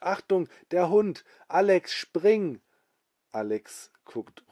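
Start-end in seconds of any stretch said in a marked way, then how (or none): 0.0-2.6 warning shout. Acted. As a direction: shout a warning across the street. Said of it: "Rummel ruft".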